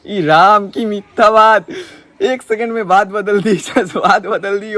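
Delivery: high, loud, hammed-up shock; mock outrage. Prank caller mocking the girl's lie, loud hammed-up outrage. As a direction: throw up your hands in fake horror.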